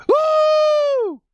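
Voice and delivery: very high, loud whoop; excited whoop. Real, not acted. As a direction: scream with joy. Overjoyed after the draft.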